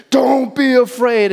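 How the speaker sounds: a sharp barked order; mock shout of a harsh command. Shown as a demonstration. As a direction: snap it like a drill command. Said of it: "you kinda shout it".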